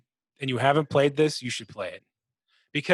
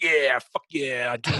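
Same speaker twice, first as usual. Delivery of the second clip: slow mock hype; slower than usual. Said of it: "you're like".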